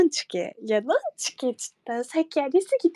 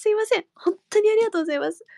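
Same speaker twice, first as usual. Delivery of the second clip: ordinary talk, nothing marked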